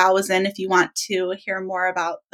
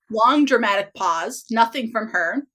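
ordinary talk

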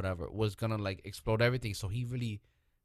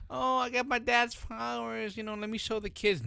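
put-on typical show-off kid; 10 semitones higher than usual. Mocking the superpowered kids he expected, high sing-out brag. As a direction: put on a bragging kid, pitched up. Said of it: "gonna be like a typical".

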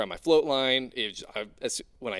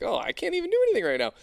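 high, fast helpless whine; hammed-up despair; 3 dB louder, much faster than usual. Diver stuck without gear, whining helplessly. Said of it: "It's like".

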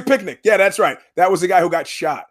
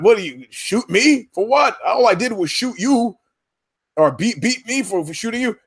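outraged disbelief; 6 semitones higher than usual. Retold or imitated. Host mimics the outraged shooter, high and incredulous. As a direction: sputter it in mock outrage. Retelling the outraged victim's view.

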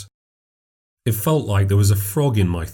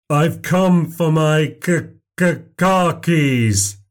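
slow, higher voice stuck on each k; slow stutter; 7 semitones higher, much slower than usual. Acted. Stranger demands his car keys, slow stutter. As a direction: stammer the k sounds, dragging.

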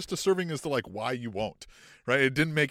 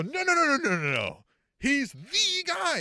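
insistent high protest; 9 semitones higher, 5 dB louder than usual. Retold or imitated. Mocking fans who insist he's the guy, high and insistent. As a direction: insist hard, drawing out "the guy". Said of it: "And they go".

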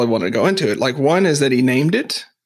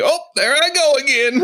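much higher, playful self-mocking line; imitating a dad; 12 semitones higher than usual. Guest imitates an emotional dad at an event, high and playful. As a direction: laugh it off like a dad catching himself tearing up. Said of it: "And he's like".

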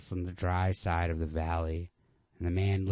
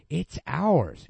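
higher, louder, slow, yearning insistence; high insistent; 9 semitones higher, 8 dB louder than usual. Acted. Girl pushing back against the man, high and insistent. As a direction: reach for the dream out loud.

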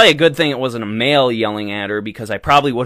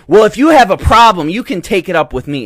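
mock stewardess, louder and higher; snippy stewardess; 6 semitones higher, 7 dB louder than usual. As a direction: throw down the challenge like a snide flight attendant. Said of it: "they say".

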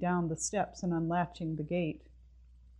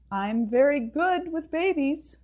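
high, louder call across the yard; 9 semitones higher, 5 dB louder than usual. Acted. Neighbour calling from the sidewalk, higher and louder. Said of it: "Missus Yardley called, a little louder".

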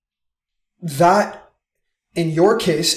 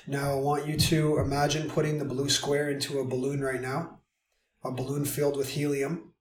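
guided meditation; 6 dB quieter than usual. Acted. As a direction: lead them gently through the imagery. Guides a balloon visualization.

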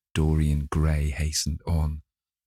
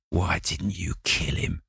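hissed question; 3 dB quieter than usual. A narrator's reading. The woman from the bar hisses at James Vane. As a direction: hiss it out. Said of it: "she hissed out".

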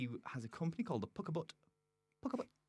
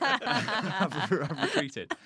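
laughing; 10 semitones higher, 8 dB louder, much slower than usual. Real, not acted. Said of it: "I've kind of gone more English there".

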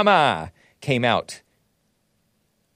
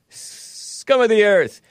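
contemptuous; 8 semitones higher, much slower than usual. Real, not acted. Host insulting the ACLU.

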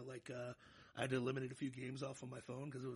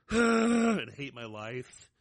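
groaning; 6 semitones higher, 17 dB louder, much slower than usual. Real, not acted. Groaning about waking early.

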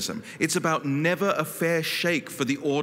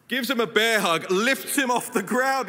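ordinary talk, nothing marked

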